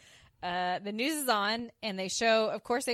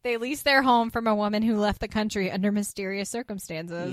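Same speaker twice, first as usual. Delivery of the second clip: louder, declarative newspaper read; reading the story; 7 dB louder than usual. Acted. [name] reading the newspaper story to Anthony, loud declarative read. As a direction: announce each scandalous detail in mock report style. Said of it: "she starts reading the story".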